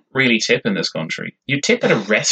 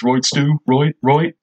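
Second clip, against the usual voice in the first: quick south Dublin accent impression, a bit higher; 5 semitones higher, much slower than usual. Shown as a demonstration. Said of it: "she'd actually talk a bit like this".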